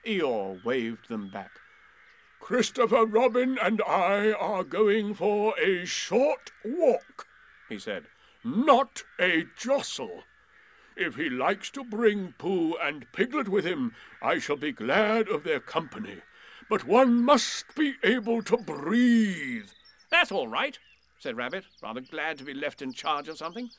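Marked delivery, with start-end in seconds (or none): 2.4-7.3 gloomy donkey voice. A narrator's reading. Eeyore voiced in story. 8.4-19.7 gloomy donkey voice. A narrator's reading. Eeyore voiced in story. 20.1-20.8 higher, brisk and bossy little rabbit; busy rabbit voice. A narrator's reading.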